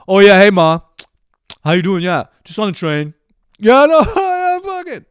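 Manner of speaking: high, booming mock phone chatter; obnoxiously loud phone call. Shown as a demonstration. Mocking a passenger phoning Ma on the train, loud. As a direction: blare it out, building to a high-pitched holler.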